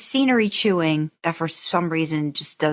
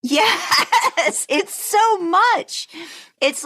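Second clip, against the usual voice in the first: high laughing yes, stretched slow; squealing laugh, drawn out; 12 semitones higher, much slower than usual. Real, not acted. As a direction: laugh through it. Laughing about cartoony accent.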